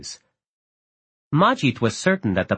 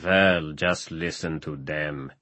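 low scornful mutter; 8 semitones lower, 5 dB quieter, slower than usual. Acted. Pasha repeats well scornfully.

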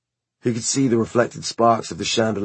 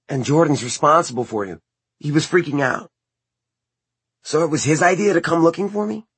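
put-on higher, questioning young man; Simon voice; 8 semitones higher than usual. A narrator's reading. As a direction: raise the voice for Simon.

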